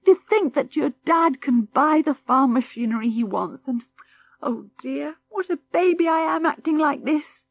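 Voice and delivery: high, tearful, halting voice; a tired mother cries with relief before the children; crying for joy. Acted. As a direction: weep through relief. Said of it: "began to cry".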